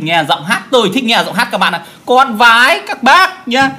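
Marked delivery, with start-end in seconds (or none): 2.1-3.7 slow, higher, hammed-up begging bow to the neighbours; mock pleading. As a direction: drag it out like a sarcastic humble plea. Mock bowing plea to neighbours. (giọng van xin nhại, chậm và cao)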